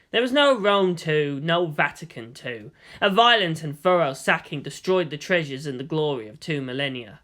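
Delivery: ordinary delivery, nothing marked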